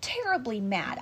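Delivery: plain reading voice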